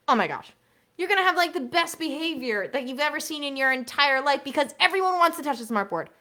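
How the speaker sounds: usual speaking voice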